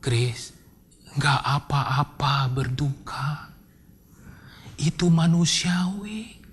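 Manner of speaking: soft, slow reassurance; hushed, tender, lingering words of comfort; gentle counsellor. Retold or imitated. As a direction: quietly give permission to grieve. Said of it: "Terus dia bilang gini".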